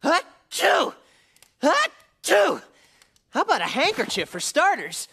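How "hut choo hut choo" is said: sneezing from a cold. Acted. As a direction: sneeze it out, nose stuffed with a cold. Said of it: "I caught this cold".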